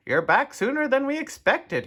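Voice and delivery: high, loud surprise; surprised principal. A narrator's reading. Principal greeting the teacher back early, surprised. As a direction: pitch it well up, taken aback. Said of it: "Mister Blansworth was surprised".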